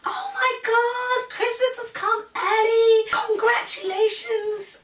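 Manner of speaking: very high, loud, drawn-out gushing; hammed-up ecstatic woman; overexcited nurse. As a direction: imitate a thrilled squeal of congratulations. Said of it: "She was so excited for me".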